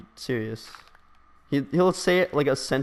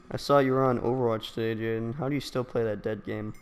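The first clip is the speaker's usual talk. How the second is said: normal talking voice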